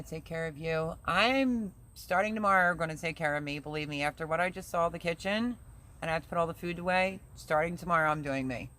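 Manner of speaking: normal talking voice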